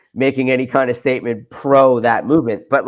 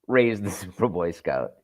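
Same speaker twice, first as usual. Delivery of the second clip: laughing punchline; 5 semitones lower, 7 dB quieter than usual. Real, not acted. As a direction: let "raised" drop away quietly into a laugh. Punchline repeated through a laugh.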